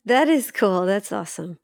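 ordinary talk, nothing marked

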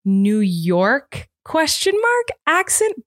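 slow, high, unsure guess at the accent; questioning. Real, not acted. Podcaster unsure of a film accent, questioning.

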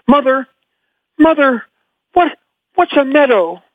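a small fawn calling to its mother; Bambi voice. Pretend. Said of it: "can you do a line from Bambi as Bambi right now".

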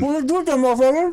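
high-pitched hyped-up challenge; mock fighting bravado. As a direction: take the dare in a high, eager voice. Mock bravado about fighting a champion.

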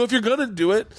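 retold plea, pitched high; mildly exasperated, voice climbing; a little upset. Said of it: "I actually got a little upset".

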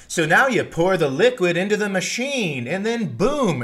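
high, loud TV chef voice; hammed-up cooking show presenter; cooking show host. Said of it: "where they're like".

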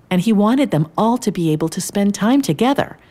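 plain reading voice

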